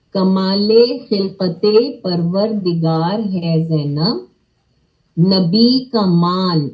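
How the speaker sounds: slow, deliberate reading with throaty ق; slow careful recitation. Shown as a demonstration. Teacher modelling the line for children, slow and careful. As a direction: model each sound clearly for students. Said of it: "اب یہ کے پہلا والا ک کی sound میں آئے گا".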